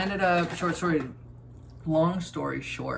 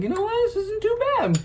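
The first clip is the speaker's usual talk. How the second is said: very high, loud squeaky quote; mock pleasant surprise; 5 dB louder than usual. Reacting to eating something unhealthy, acted high-pitched exclamation. Acts out own reaction to food.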